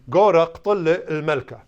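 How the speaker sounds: "Gora qtl al-malka" said slowly and loudly; careful teacher demo; clear Aramaic model sentence. Shown as a demonstration. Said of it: "you put a lemeth before the object".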